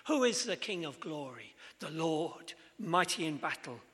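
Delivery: usual speaking voice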